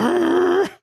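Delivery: high, drawn-out "errr" squeal; imitated tire screech. Storyteller mimics a car skidding two blocks, high screech.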